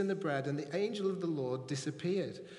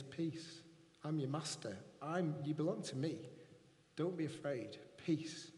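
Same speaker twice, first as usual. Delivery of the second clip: gentle hush; 3 semitones lower, 7 dB quieter than usual. Acted. God's comforting message voiced.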